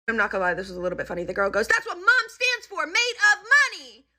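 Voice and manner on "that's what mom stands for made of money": imitating a spoiled kid talking back; shrieky mock tantrum; bratty comeback. Girl yelling back at mom, retold.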